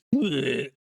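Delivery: imitated vomiting noise; mock retching. Kathy throwing up in the sink, retching. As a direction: make a short throwing-up sound. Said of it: "throws up everywhere in the sink".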